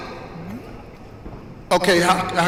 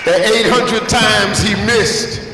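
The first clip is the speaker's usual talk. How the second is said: motivational preaching; 5 semitones higher than usual. Real, not acted. Motivational speaker to audience on Jordan's misses, preaching emphatically. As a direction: drive each phrase home like a preacher, with weighty pauses.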